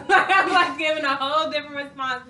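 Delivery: loud high laugh through the words. Real, not acted. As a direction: burst out laughing mid-sentence. Laughs about son crying at school.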